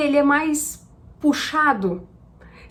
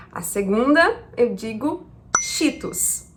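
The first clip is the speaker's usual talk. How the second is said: ordinary delivery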